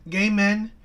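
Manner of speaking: usual speaking voice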